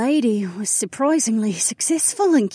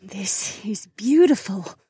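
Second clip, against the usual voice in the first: slow, high countess in wonder; high-pitched, awed old dragon; marvelling; 12 semitones higher, slower than usual. A narrator's reading. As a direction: take it in slowly with awe. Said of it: "she marveled".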